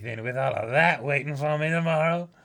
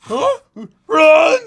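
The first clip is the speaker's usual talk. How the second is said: very high, loud shout; panicked yell; 14 dB louder than usual. Acted. Guard panicking as the intruder escapes. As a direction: scream at them to run.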